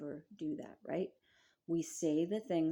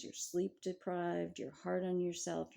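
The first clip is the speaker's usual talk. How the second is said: normal talking voice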